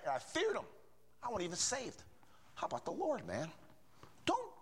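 high-pitched, fervent preacher; impassioned preaching. Real, not acted. Exhorts the congregation fervently.

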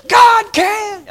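harsh, very high, loud mock crowd yell; imitated wild church response; congregation shouting back. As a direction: holler the response like a fired-up congregation. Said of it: "And they'd say".

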